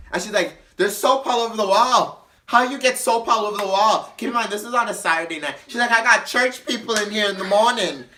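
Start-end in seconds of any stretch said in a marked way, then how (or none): 0.8-4.1 high, loud mock outrage; imitating an irate cleaner; indignant complaint. Mary Jane complains about the mess. 5.9-8.0 high, loud mock outrage; imitating an irate cleaner; indignant complaint. Mary Jane complains about the mess.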